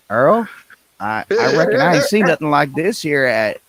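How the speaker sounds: gawk like a country race fan. Pretend. Mock Southern race fan again.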